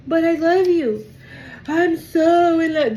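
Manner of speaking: high, slow swooning plea; mock lovesick suitor. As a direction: gush like a lovesick admirer. Mocking the rejected lover.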